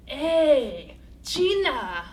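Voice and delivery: imitating a man's shout on the street; street harasser calling out. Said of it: "a man is approaching us".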